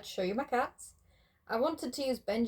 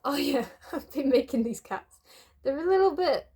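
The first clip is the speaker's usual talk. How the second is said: usual speaking voice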